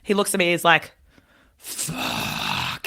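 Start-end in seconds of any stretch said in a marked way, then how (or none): 1.6-2.9 deep, dragged-out groan of dismay; a man sinking at bad news; grumpy groan. Grumpy stranger swears at kids.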